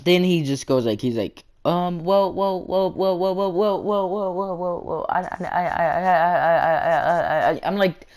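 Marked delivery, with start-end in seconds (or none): 1.6-7.6 rapid-fire stuck-word stammer, higher than usual; mock stuttering. Kid stalling when asked to explain himself, exaggerated stutter. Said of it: "can you quit stuttering".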